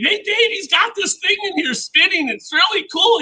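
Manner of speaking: mock yell to Dave, no real volume; imitated excited call-out. Relative calls Dave to see the spinning thing, excited mock yell. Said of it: "he yells at Dave".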